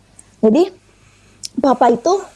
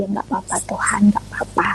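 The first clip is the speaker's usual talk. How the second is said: tearful murmur; 2 semitones lower, 6 dB quieter than usual. Retold or imitated. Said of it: "Saya cuma nangis saja".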